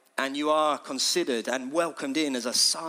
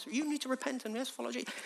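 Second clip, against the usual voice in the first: soft, high, timid little voice; tiny, polite mock sermon; meek mock preacher; 6 semitones higher, 11 dB quieter, faster than usual. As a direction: mimic a half-hearted preacher very quietly. Mimics a meek version of John.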